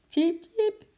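high, squeaky chirp with a sigh; a little squirrel peeps, bored and sighing. A narrator's reading. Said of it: "piepte Ekie zuchtend".

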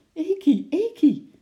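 high, loud startled cries; alarmed calling. A narrator's reading. Girl crying after her departing squirrel, alarmed shout. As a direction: call the name in sudden alarm. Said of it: "riep ze geschrokken".

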